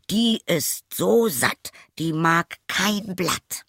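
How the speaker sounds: high, slow, emphatic reassurance; son's assurance. A narrator's reading. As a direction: assure father, drawing out every word. Son assures his father. (beteuernder Sohn)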